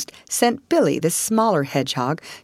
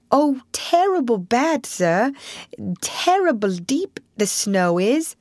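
higher-pitched, deferential hedgehog voice; earnest little hedgehog recounting his troubles; humble young hedgehog; 8 semitones higher, slower than usual. Acted. Young hedgehog explains himself to the Rat, humble.

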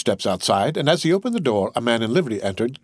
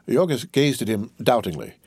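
usual speaking voice

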